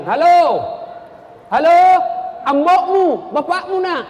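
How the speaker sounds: hammed-up loud, high phone hollering; calling into phone. Illiterate rich man phoning his son, calling out loudly. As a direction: holler down a bad line.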